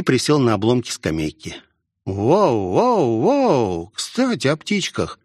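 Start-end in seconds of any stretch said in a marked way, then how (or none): 2.0-5.2 put-on high-pitched, astonished girl; rising whoas into an amazed question; surprised. A narrator's reading. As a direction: voice a girl taken aback. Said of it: "удивлённо произнесла Мелвин".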